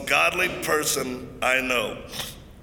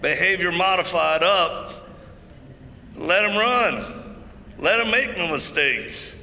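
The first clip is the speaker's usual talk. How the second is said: preaching; 4 semitones higher than usual. Acted. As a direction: preach it from the pulpit with conviction. Preaching to the congregation.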